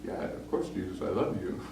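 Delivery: whispered, unsure reply; hushed answer. Retold or imitated. Peter whispering his answer to Jesus. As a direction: breathe it out barely voiced. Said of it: "and he goes".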